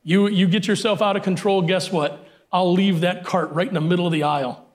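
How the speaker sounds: usual speaking voice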